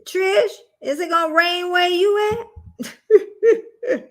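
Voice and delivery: slow, high, half-laughing plea to a friend; mock-worried question to Trish. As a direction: drag it out, laughing as you ask. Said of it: "I'm like".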